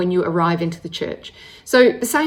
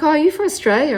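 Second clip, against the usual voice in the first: higher-pitched imitation of pleased surprise; surprised question; 8 semitones higher, faster than usual. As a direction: act out spotting a fellow countrywoman. Acting out surprised Aussie stranger.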